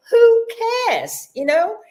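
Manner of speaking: a high, loud, carefree "Who" that drops into a casual "cares"; carefree shrug. Retold or imitated. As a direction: toss it off like nothing matters. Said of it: "And I think".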